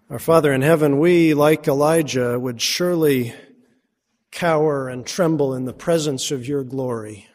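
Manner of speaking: reverent prayer in the preacher's own voice, only lightly set apart; a hint of a hushed pastoral prayer. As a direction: pray it humbly to the Father. Leads closing prayer.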